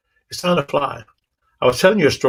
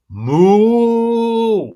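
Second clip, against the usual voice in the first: cow moo; 10 semitones higher, 5 dB louder than usual. Retold or imitated. Herd cow mocking the runaway.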